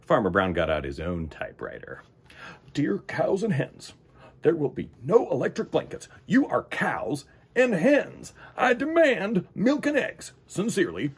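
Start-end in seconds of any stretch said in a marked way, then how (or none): 2.7-11.1 higher, firm demanding voice; higher, firm dictated letter; stern farmer's letter. Acted. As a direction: lay down the law. Farmer reads his own letter.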